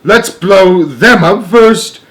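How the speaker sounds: slow, high, loud war-hungry general; gung-ho general. Acted. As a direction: bellow for an attack, slow and loud. Army chief wants to attack.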